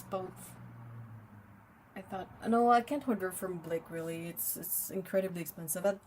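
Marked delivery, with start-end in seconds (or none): none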